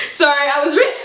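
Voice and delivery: breathless laughing apology. Real, not acted. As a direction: laugh through the words. Laughing hard at herself.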